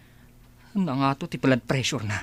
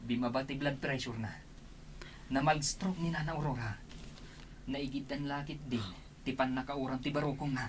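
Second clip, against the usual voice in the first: much softer, as if through a door; distant overheard voices; far-off hushed talk; 5 semitones higher, 10 dB quieter than usual. Acted.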